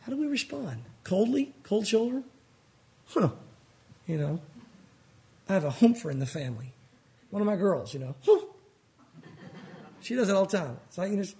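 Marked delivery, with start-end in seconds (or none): 3.1-3.3 a short sulky huff through the nose. Retold or imitated. Imitating a sulky daughter's humph at dad. 8.2-8.4 sulky huff. Retold or imitated. Humpher daughter's huff.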